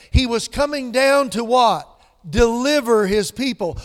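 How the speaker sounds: coming down to what, shouted high; loud preaching. Acted. Preacher asking the congregation, booming rhetorical question. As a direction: hit Deliver hard and loud. Loud then hushed repeat.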